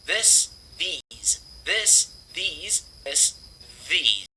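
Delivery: THIS with short i, then THESE with long ee; THIS against THESE, minimal-pair drill; short-long vowel contrast. Shown as a demonstration. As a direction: alternate clipped THIS and stretched THESE. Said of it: "é um som curtinho".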